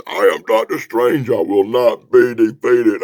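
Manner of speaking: slow, loud heroic declaration; hammed-up superhero shout; Doctor Strange. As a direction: proclaim defiance, ending in a battle cry. Acts Doctor Strange defying demon.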